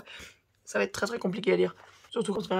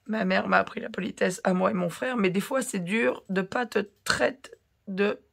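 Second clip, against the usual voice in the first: normal talking voice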